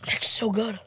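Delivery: unvoiced whisper. Real, not acted. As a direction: breathe it out softly. Whispered with full mouth.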